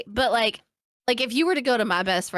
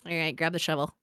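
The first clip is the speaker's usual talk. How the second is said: low, softer aside; deadpan conspiracy; 5 semitones lower, 6 dB quieter than usual. Acted. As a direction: play the accomplice, dropping the voice. Best friend as partner in crime.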